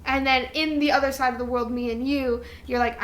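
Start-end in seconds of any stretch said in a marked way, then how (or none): none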